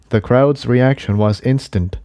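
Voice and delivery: plain reading voice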